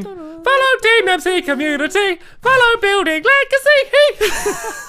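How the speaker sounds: high, drawn-out proclamation; hyped credit reading. Pretend. Reading map credits over the soundtrack, hyped. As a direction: call out the names high and stretched.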